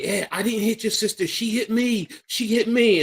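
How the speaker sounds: high-pitched mock pleading; panicked protest. Cornered man protesting to his girlfriend's brother, panicked protest.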